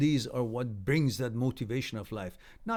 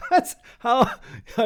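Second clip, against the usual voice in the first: high, loud laughter running into words; 11 semitones higher, 7 dB louder than usual. Real, not acted. Laughing at own teacher joke, high and loud.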